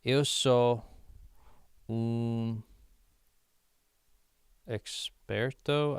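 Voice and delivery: slow, searching learner's Portuguese; unsure, piecing the sentence together; halting Portuguese attempt. Real, not acted. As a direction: feel for each word, with long pauses. Working out an unknown Portuguese word.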